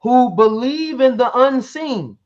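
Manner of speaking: UNSEEN drawn out in a loud, high, slow reading; emphatic key verse. Real, not acted. Debater reading the important verse to his opponent, slow and emphatic. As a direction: stress "believe in the unseen", loud, high and deliberate. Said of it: "this part is important".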